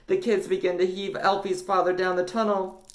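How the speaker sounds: plain reading voice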